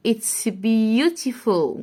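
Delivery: "It's beautiful" very slow; slow read. Shown as a demonstration. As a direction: read it slowly for the class. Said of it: "reading slowly".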